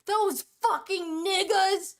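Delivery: slow, loud imitated angry mother; angry rant. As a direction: spit it out like his furious mom. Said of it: "My mom came home and said".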